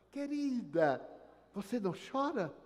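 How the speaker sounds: grieving widow. Retold or imitated. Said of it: "a viúva veio".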